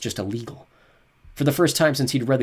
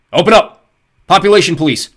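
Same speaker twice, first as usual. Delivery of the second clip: loud, high barked order; shouted command; 8 semitones higher, 15 dB louder than usual. A narrator's reading. Police at the door, shouted command. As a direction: pound the door with your voice. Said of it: "the command".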